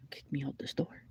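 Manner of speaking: whispered, rising in disbelief; incredulous whisper. Real, not acted. Venting alone about being kicked out, whispering. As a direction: hiss it out under your breath.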